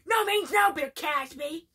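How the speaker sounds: defiant Cartman. Retold or imitated. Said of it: "He's like".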